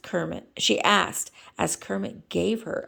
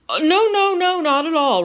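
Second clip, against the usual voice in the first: flustered Kermit voice; 11 semitones higher, 9 dB louder than usual. Acted.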